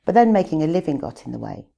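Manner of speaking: normal talking voice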